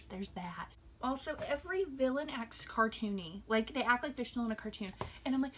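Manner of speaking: ordinary talk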